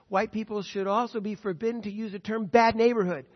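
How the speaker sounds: normal talking voice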